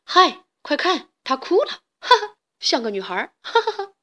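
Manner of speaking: teasing bully laughing at him; mocking taunt. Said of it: "嘲笑道". (嘲笑地起哄)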